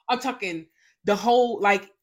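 dramatic gasping reenactment. Retold or imitated. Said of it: "that's the reenactment".

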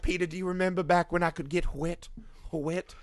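a lightly voiced lusty old Wendy; mock her purring about the past; put-on seductive granny voice; hammed-up sultry Wendy. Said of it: "She's like".

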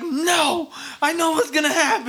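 high-pitched koala protest, laughing at the end; high, hammed-up koala voice. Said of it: "koala's like".

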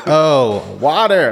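"Oh, water" in a high American "wahter"; mock American accent. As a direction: imitate the flight attendant's American "water", pitched high. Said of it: "She's like".

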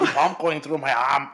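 badly faked Austrian bodybuilder; bad accent. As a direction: drag it out in a botched Austrian accent. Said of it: "What was that accent?".

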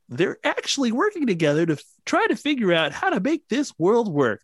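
high mock quoting voice; air quotes. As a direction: read it like a pompous official quote. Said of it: "That's my quote voice from now on".

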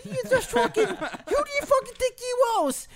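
very high-pitched mock Australian outrage; Australian accent. Ranting at co-host, shrill put-on Australian accent. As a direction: squeal a tirade in fake Australian. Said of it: "well, then be Australian".

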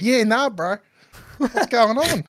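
high, loud Australian bloke impression; mock broad Aussie accent. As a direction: ham up a matey Aussie accent. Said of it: "hop off the call and he's like".

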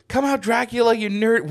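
high-pitched mock taunt; Moon Knight. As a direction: call out the villain in a hammed-up high voice. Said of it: "Moon Knight walks in and goes".

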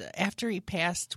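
normal talking voice

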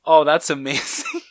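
high, breaking into laughter; laughing delight. Real, not acted. Co-host cracking up at a listener's answer, laughing. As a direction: say it through a laugh.

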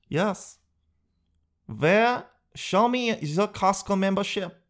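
mock strict cashier; pushy clerk. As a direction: press the customer for the card. Cashier pressing.

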